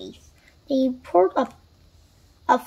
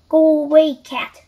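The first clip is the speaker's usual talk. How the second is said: louder read-aloud shout, easing on the last word; mild shout; 2 semitones higher, 8 dB louder than usual. A narrator's reading. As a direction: shout it lightly, as in a storybook. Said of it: "shouted Kipper".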